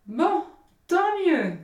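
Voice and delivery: high, loud hearty cry; the miller to Hans, high and hearty; hearty exclamation. Acted. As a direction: boom it out while slapping his back. Miller hearty, slaps Hans's back.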